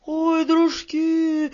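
very high, drawn-out wail; high little-man cry of dismay; panicked cry. A narrator's reading. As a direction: cry out slowly to your friends, way up high. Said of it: "закричал мужичок с бородой вроде как пакля".

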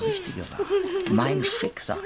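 high, giggling words; a mocking laugh through his own fate. Laughs while naming his destiny.

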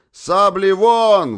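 high, loud and slow drawn-out command; battle command. A narrator's reading. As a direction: draw it out across the line. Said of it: "раздалась его команда".

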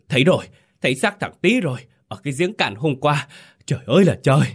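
high, cracking horrified cry; shrill with terror. A narrator's reading. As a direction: shout the awful find in a panic. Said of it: "Giọng ông lạc đi the thé vì kinh hãi tột độ". (giọng the thé, lạc đi vì kinh hãi)

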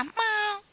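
sharp high cat cry; cat meow. Acted. Cat meows.

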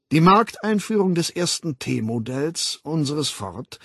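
slow, ceremonious proclamation; solemn lecturer. Acted. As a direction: announce it reverently to the students. Weltaufsichtsrat verkündet feierlich. (feierlich, gedehnt dozierend)

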